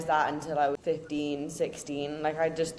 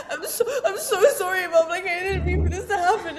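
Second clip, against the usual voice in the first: high, loud, wailing put-on sob; mock tearful child; 11 semitones higher, 8 dB louder than usual. Daughter mimics her younger self apologising to mum, high and whiny. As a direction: whine it like a crying kid caught out. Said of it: "I'm like".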